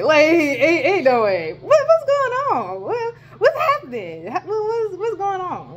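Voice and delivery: loud, then very high incredulous squeal; mock disbelief. Student retelling the bus trouble, high mock-disbelieving squeal.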